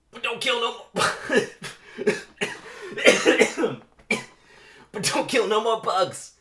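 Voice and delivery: very high, loud strained yell; shouted plea. Acted. Mosquito yelling through glass at the driver, high loud. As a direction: scream it at the glass. Moose Blood yells at driver.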